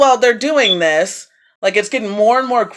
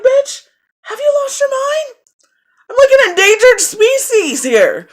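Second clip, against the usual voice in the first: way higher, laughing disbelief; incredulous retort. Retold or imitated. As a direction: squeal at them in disbelief. Her imagined retort to neighbours.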